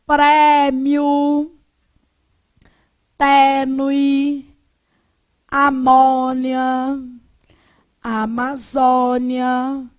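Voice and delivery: open, un-nasalised stressed vowels in prêmio, tênue, amônia, Amazônia; Portugal pronunciation. Shown as a demonstration. Teacher demonstrates acute-accent words to class, prêmio, tênue, amônia, Amazônia with long open vowels. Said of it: "não nasaliza. E, portanto, eles usam o acento o quê? Agudo".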